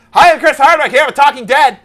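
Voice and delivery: loud, high TV host intro; big talk-show host welcome; Chris Hardwick host voice. Retold or imitated. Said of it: "here's an episode of Talking Dead".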